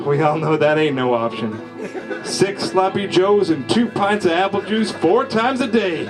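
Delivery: ordinary delivery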